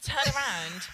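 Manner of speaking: normal talking voice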